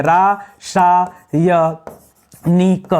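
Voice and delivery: slow spelled-out word. Shown as a demonstration. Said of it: "तो रा होता है यहाँ पर".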